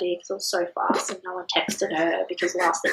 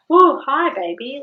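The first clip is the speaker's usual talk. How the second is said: loud, higher whoa; mother meeting her baby, loud and high; delighted greeting; 6 semitones higher, 8 dB louder, slower than usual. Retold or imitated. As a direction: greet the newborn with a burst of joy.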